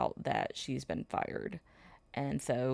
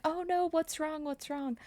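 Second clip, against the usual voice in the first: much higher, fussing concern; worried farmers; 13 semitones higher than usual. Retold or imitated. Farmers fussing over the sobbing sister, high alarmed concern. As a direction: gasp over her like worried neighbors. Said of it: "And they're like".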